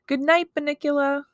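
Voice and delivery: put-on sing-out child's goodnight; little boy's goodnight. A narrator's reading. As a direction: call goodnight to the pet, high. Toby's fond goodnight to rabbit.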